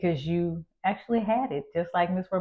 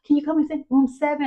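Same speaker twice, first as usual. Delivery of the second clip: urgent plea; 8 semitones higher, faster than usual. Retold or imitated. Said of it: "and I was like".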